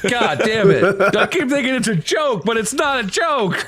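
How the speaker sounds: laughing. Retold or imitated. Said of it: "And I'd be like".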